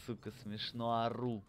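ordinary talk, nothing marked

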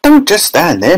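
high, exasperated cry at a husband; high-pitched urgent scolding; Missus Gloop. A narrator's reading. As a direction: scream at your husband to act. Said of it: "Missus Gloop screamed at Mister Gloop".

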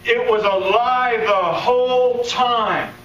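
slow, high preacher's declaration; emphatic preaching. Acted. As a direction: lean on every word from the pulpit.

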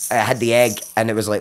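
ordinary talk

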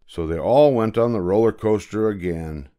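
ordinary delivery, nothing marked

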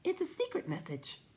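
very high, tiny voice; timid creature. A narrator's reading. Will-o'-the-Wisp guards its secret, high timid voice. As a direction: pipe it up uneasily.

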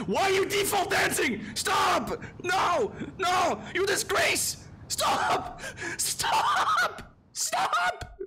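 furious shrill shout. Pretend. Yelling at a default dancing character to stop, in a high furious shriek. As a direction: shriek at them to stop, breaking into laughter.

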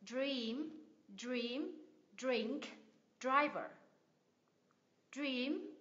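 "Dream", "drink", "driver" said singly with D gliding into R; DR blend. Shown as a demonstration. Teacher modelling for learners to practise, clear DR in "Dream". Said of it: "mình chuyển ra cái âm R này".

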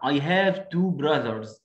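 slow, clear textbook English; drawn-out classroom line; very slow model reading. Shown as a demonstration. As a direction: read it word by word for kids. Reading child's line slowly.

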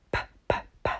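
whispered p, p, p; whispered sounds. Shown as a demonstration. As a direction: breathe out each p. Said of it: "What's the first letter?".

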